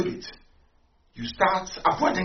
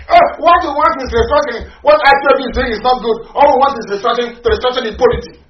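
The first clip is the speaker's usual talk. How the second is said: loud, fast, higher mockery of their slogan; mocking slogan; 7 semitones higher, 10 dB louder than usual. Speaker mimics opponents' restructuring slogan, loud and mocking. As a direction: parrot the opponents' line to ridicule it. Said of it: "Talking rubbish".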